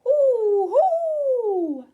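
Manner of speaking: high, loud owl hoots. Pretend. Owl character hooting to the children. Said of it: "I say".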